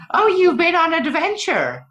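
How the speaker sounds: put-on very high, loud, slowed-down quavering old healer voice; tiny healer woman. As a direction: squeak it out like a tiny woman in huge glasses.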